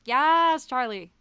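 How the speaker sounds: cheering. Retold or imitated. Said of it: "I was like".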